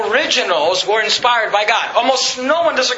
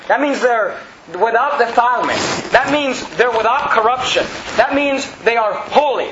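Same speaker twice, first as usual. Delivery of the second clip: normal talking voice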